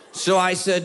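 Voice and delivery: normal talking voice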